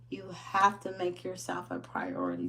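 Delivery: ordinary talk, nothing marked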